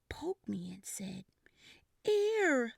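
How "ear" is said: put-on baby voice. Baby Bob speaks.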